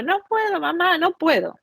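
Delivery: put-on high, pleading little-boy voice; imitating a five-year-old begging his mom; crying child. A boy back at the start, imitating his plea to mom, high and tearful. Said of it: "llorando y diciendo".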